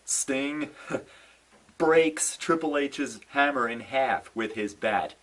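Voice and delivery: ordinary talk, nothing marked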